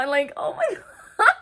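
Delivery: high, loud laughing disbelief; helplessly amused, pitched high and loud; amazed laughter. Real, not acted. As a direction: laugh through your astonishment. Her own amazed reaction, laughing.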